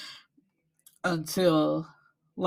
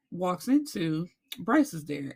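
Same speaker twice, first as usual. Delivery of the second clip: ordinary delivery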